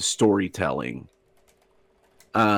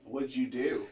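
far from mic; 12 dB quieter than usual. Real, not acted. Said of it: "Sorry if I sound far away from the mic".

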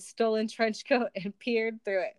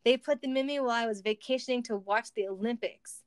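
plain reading voice

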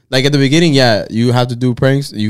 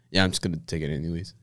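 much softer, lower, offhand; low defiant mumble; 8 semitones lower, 15 dB quieter, faster than usual. Retold or imitated. Drinker telling the cop he'll keep drinking, low mumble. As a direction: shrug it off quietly.

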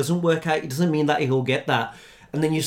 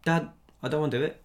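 quick, soft child's plea, lightly acted; reluctant child; 7 dB quieter, faster than usual. Child telling dad about quitting martial arts, softly reluctant. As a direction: quietly own up, in a hurry. Acting own child asking to quit.

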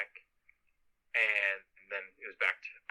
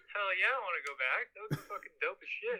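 higher, eager, retelling his own hyped reply; excited yes; 10 semitones higher than usual. Retold or imitated. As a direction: play up the kid who can't wait for a safari. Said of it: "And I'm like".

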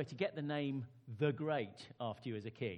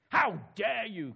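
slow, very loud, outraged cry; furious shout; 8 semitones higher, 14 dB louder, slower than usual. Acted. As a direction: shout at the disobedient wave. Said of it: "Canute shouted".

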